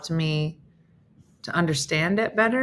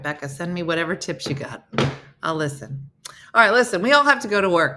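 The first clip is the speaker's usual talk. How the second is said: ordinary delivery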